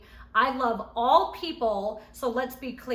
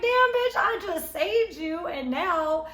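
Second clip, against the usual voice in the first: much higher and louder, then slowing down; indignant retort; 5 semitones higher, 5 dB louder, slower than usual. Retold or imitated. Scolding Tanisha for turning on her, mock outrage. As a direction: act out an outraged comeback, stretching the end. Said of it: "I'm like".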